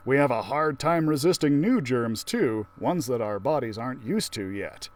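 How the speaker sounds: ordinary delivery, nothing marked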